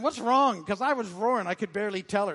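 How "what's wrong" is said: wife calling out. Retold or imitated. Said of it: "Alice came running".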